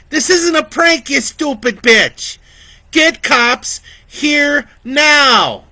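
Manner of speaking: high, loud, slow furious yell; furious shout. Acted. Vicky screams at the doubting dispatcher, furious. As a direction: scream the insult in rage.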